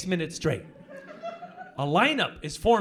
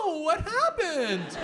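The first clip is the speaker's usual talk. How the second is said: mock concern; 11 semitones higher, 3 dB louder, slower than usual. Sarcastic sympathy to tour guide.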